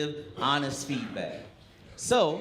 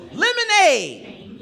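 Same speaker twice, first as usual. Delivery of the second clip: much higher, louder, stretched slow shout; triumphant punchline; 9 dB louder, much slower than usual. Retold or imitated. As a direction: belt the punchline like a thrilled kid. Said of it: "And he goes".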